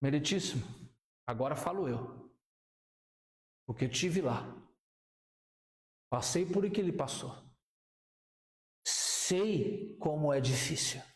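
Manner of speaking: defense advocate. Retold or imitated. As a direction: speak as the solemn lawyer rising to plead. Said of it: "o teu advogado levanta".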